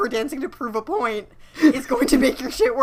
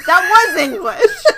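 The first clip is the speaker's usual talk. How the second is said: much louder, higher, slow laughing yell; laughing outburst; 8 semitones higher, 11 dB louder, slower than usual. Real, not acted. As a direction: laugh out the disbelief.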